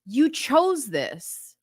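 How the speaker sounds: acted-out upset husband; frustrated. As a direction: lay the blame slowly, word by word. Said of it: "really frustrated, right? Because if I were Steve".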